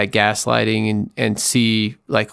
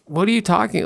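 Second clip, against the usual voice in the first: incredulous denial; 8 semitones higher than usual. Retold or imitated. Repeats the gaslighting reply.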